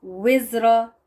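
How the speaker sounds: विज़रा with rounded lips on वि, a clear w sound. Shown as a demonstration. Teacher demonstrating the Arabic word to students, lips rounded. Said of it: "पहले होंठों को गोल कर लें".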